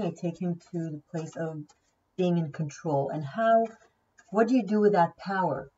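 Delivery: ordinary talk, nothing marked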